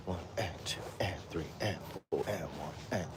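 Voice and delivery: whispered count. Shown as a demonstration. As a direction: breathe the beats out under your breath. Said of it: "trickier if you do it like that".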